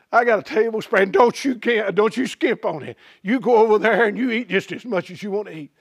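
usual speaking voice

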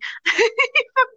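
squealing giggle at the cat; high-pitched burst of laughter. Real, not acted. Cat attacking her.

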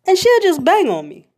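ordinary talk, nothing marked

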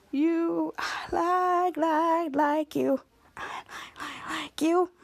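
soft, dazed stammer fading to a whisper; swooning, stammering into a whisper; lovestruck stammer. Retold or imitated. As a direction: trip over the words, smitten. Lovestruck girl stammers, drooling.

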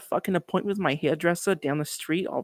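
huffy mock New Yorker. Guest storms off to the hairdresser in the bit, New Yorker accent. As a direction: excuse yourself like a brusque New Yorker. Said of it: "that very New Yorker".

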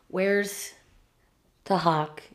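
normal talking voice

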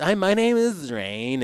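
mock hippie, lightly voiced. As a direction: spoof a spacey hippie saying hello. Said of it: "a fucking hippie like this".

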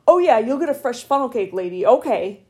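sarcastic teacher voice to a rude customer; teacher mom voice. Retold or imitated. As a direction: talk down like a stern teacher. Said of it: "talking with my teacher face, my mom face".

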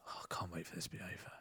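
fast, hushed whisper; quick whisper. Retold or imitated. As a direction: sneak the words out in a rushed whisper. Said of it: "I whispered to the lad next to me".

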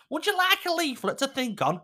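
high, sweet doorstep preacher voice; chirpy knock-at-the-door evangelist; mock Jehovah's Witness at the door. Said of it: "She's like a Jehovah's Witness".

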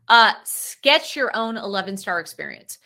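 usual speaking voice

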